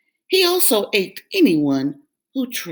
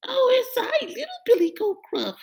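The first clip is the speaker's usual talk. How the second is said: high, meek goat voice; little goat answering the troll; timid little goat; faster than usual. Acted.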